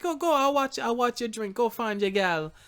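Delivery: high-pitched mock Jamaican accent, easing down slightly; bad Jamaican accent. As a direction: imitate a Jamaican woman urging someone off, high voice. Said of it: "that was a terrible Jamaican accent".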